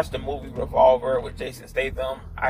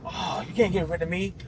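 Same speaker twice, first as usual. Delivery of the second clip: higher mock-indignant alter ego; angry inner voice; 4 semitones higher than usual. Shadow self refuses to be got rid of, mildly angry. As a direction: protest like a rejected best friend. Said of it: "he was getting angry".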